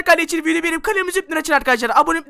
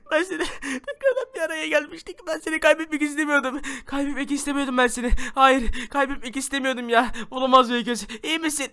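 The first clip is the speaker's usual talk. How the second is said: acted grief; 5 semitones lower, 7 dB quieter than usual. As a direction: mourn quietly over a loved one. Father grieves over child. (alçak sesle, yavaş ağıt)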